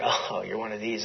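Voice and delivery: low, soft imitation of weary disdain; eye-rolling dismissal. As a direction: dismiss them with an audible eye-roll. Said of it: "he kinda rolled his eyes like".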